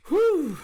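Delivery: high breathy whew of release. Real, not acted. Streamer reacting to a moving card, a high whew. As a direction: blow out the feeling.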